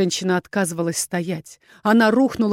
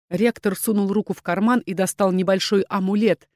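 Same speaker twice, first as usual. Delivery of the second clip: ordinary delivery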